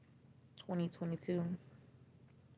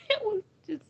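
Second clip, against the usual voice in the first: real grief spilling over; crying; 5 semitones higher, 5 dB louder than usual. Real, not acted. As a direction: voice breaking into a sob. Crying over daughter's death.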